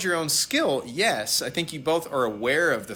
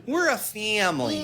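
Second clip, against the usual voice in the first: higher, mocking slogan voice; mocking corporate slogan; 6 semitones higher than usual. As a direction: parrot the company line with scorn. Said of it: "sell this idea that we're a family".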